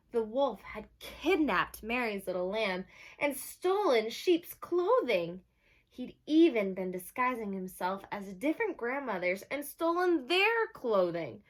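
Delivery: lively read-aloud for a class; bright picture-book reading; reading to children. Acted. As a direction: announce the title like storytime. Teacher reads the story aloud.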